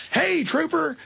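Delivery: butter up the trooper with mock cheer; charming act. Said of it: "I put a charming, you know, uh, demeanor on".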